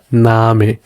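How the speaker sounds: "Name" with a drawn-out ah; long A. Shown as a demonstration. Teacher repeating for learners, long A in "Name". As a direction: hold the first vowel of "Name". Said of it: "as a long A".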